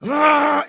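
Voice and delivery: high, loud mock scream; imitated scream. Daughter hit by a brain storm, imitated scream. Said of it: "we're talking l-, scream".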